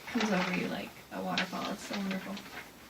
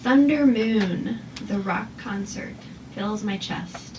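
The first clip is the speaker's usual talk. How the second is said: slow, deliberate haiku reading; poem reading; 6 dB louder, slower than usual. Shown as a demonstration. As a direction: read it slowly, savoring each line. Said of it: "So with sound, I have".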